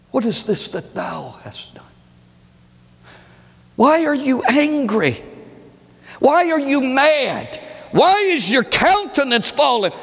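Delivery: high, pleading questions of a father; God questioning. Acted. God questioning his son Cain, high and pleading. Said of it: "he's gonna ask you".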